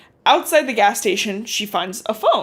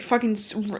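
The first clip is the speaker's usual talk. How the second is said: ordinary delivery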